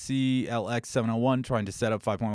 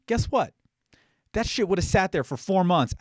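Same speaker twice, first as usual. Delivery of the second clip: ordinary delivery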